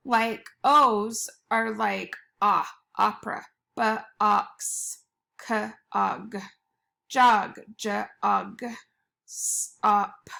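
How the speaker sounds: each sound pulled apart slowly; teacher segmenting lag and gas; sounding out letters. Shown as a demonstration. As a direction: stretch out every sound for the class. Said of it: "sounding out the letters".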